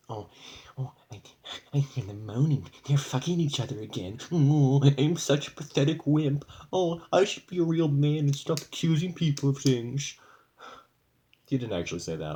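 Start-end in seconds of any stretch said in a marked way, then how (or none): 0.1-10.2 higher, louder whiny mock voice; mocking whiny stepdad. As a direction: mimic him as a pathetic whiner. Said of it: "your paranoid brain is just like".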